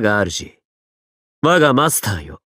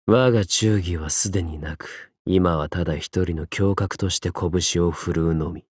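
low, hushed resolve; quiet, brooding declaration; solemn vow; 10 semitones lower, 6 dB quieter than usual. Acted. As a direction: state it gravely, soft and very low. Character solemnly declares his lost loyalty.

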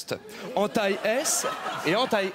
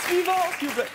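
raised call to the queue; calling out next; 9 semitones higher than usual. Acted. Clerk at the counter calling the next person, raised voice. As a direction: summon the next in line from the counter.

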